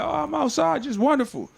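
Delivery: high-pitched, airy mock contentment; carefree mock voice. Imagining someone with no haters outside, mock carefree.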